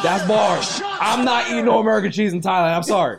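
high loud laughing outburst; excited shout. Real, not acted. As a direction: shout it while cracking up.

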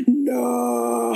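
long drawn-out, hammed-up "no"; guttural mock wail. Host imitates bride seeing the fallen cake, guttural mock wail. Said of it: "that tone that Alexa did".

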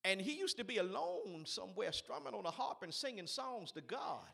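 loud, fervent preacher; preaching at full force. Acted. Preacher stirring the congregation about David's harp. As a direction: drive it home to the congregation.

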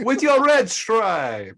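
high, loud, drawn-out Jamaican lilt; mock Jamaican accent. Joking about Air Jamaica, in a loud, slow Jamaican accent. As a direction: stretch it out loudly in a hammed-up Jamaican accent.

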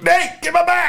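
high-pitched call for help. Retold or imitated. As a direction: yelp it up high.

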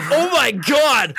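slow loud grossed-out groan; disgusted coworkers. Retold or imitated. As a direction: drag out the disgust. Coworkers smelling sewage.

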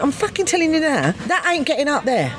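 high, insistent through laughter; insist, laughing; laughing insistence. Retold or imitated. Woman refusing husband's demand, high laughing insistence.

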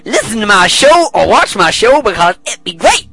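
high, loud hammed-up ad pitch; mock commercial voice. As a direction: sell the show like a cheesy ad. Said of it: "Hello, this is the commercial break".

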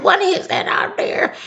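put-on very high, quavering old lady; high old woman voice. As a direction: squeak it like a startled old woman. Old woman character asks.

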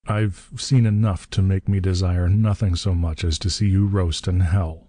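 plain reading voice

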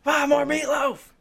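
high, slow, drawn-out holler; stretched-out mock shout, pitched high; child's yell. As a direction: yell for Mom like a demanding kid. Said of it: "to introduce to your child to start yelling".

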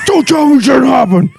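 shouting movie quote. Retold or imitated.

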